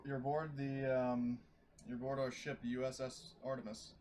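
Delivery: normal talking voice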